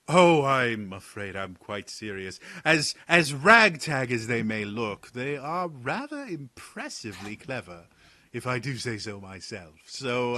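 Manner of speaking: a wry noble teasing the jumpy guards; Talon's voice. Acted. Talon scolds the guards for their glaives, mock weary.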